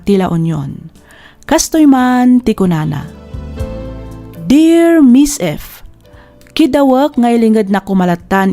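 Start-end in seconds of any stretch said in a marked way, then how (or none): none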